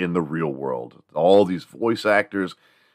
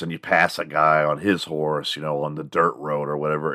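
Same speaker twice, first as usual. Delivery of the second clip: normal talking voice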